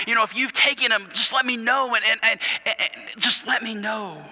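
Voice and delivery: begging Mary. Retold or imitated. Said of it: "She's begging with this guy".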